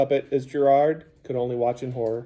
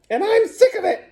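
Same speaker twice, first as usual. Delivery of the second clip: squeaky sock puppet. A narrator's reading. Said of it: "squeaked Steve".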